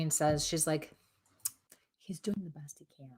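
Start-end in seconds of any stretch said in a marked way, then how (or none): 2.0-3.1 soft, half-whispered aside; hushed relayed quote. Retold or imitated. She passes on what the spirit says of him, hushed. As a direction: relay it gently, almost in a whisper.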